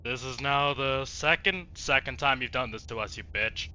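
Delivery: ordinary talk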